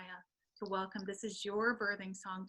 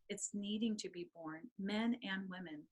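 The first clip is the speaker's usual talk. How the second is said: usual speaking voice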